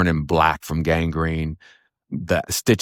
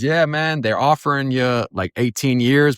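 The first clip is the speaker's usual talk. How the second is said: lawyer relaying offer; 6 semitones higher than usual. Retold or imitated. Acted lawyer relaying a plea offer.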